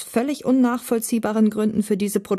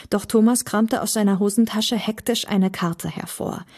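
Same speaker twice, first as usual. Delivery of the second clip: ordinary delivery, nothing marked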